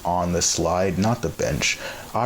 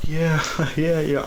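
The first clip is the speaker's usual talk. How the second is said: high, sheepish yeses through a laugh; imitating an awkward laugh-off; 8 semitones higher than usual. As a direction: chuckle and agree to brush it off. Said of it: "I kinda laughed it off".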